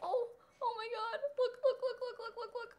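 high giggling look, look, look; excited squeal. Real, not acted. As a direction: squeal with delight, laughing. Squealing over puppy.

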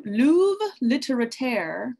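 hammed-up French accent on the name; mock French accent. Host jokingly naming a French journal in a French accent. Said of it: "that is my French accent".

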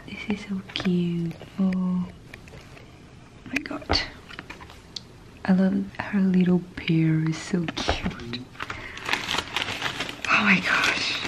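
soft ASMR whisper; hushed unboxing whisper. Real, not acted. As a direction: breathe the words close to the mic.

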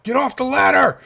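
high, loud command; frantic yell. Real, not acted. Gamer yelling at the thing on the ladder. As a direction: shout it in panic.

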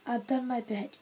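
plain reading voice

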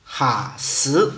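“哈死”的“哈”重读，低沉，念第二声; 把“哈”念得重一点、低一点，第二声; 温州话重音发音. Shown as a demonstration. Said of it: "念第二声". (“哈死”，哈字重读低沉，念第二声)

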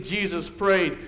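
ordinary talk

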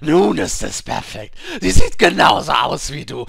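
loud, high crowing, stretched words; manic gleeful shouting. A narrator's reading. Apothecary gloating over the crushed grandmother's portrait, manic shout. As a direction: shout it in wild triumph. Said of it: "rief der Apotheker, während er tanzte".

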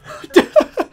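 a burst of high giddy laughter; breathless relieved laugh. Real, not acted. Relief after escape.

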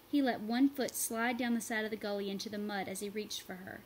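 usual speaking voice

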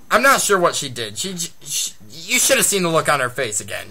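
ordinary talk

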